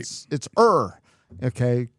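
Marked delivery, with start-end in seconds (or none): 0.6-1.0 "er" with a firm, held R; fully sounded R. Shown as a demonstration. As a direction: hold the R in "er". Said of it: "they've got it down".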